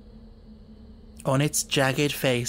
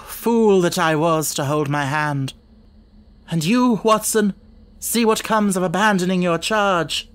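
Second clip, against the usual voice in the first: raised, higher-pitched self-reproach turning to blame; bitter reproach; 4 semitones higher, 4 dB louder than usual. A narrator's reading. Holmes blames himself and Watson for the death, angry reproach. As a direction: berate yourself, then your companion.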